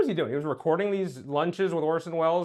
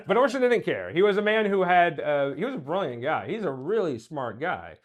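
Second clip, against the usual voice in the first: ordinary talk, nothing marked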